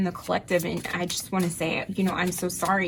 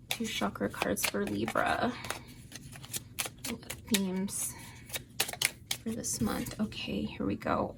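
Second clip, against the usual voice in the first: hushed; 3 semitones higher, 9 dB quieter than usual. Real, not acted. Quietly asking Spirit for cards.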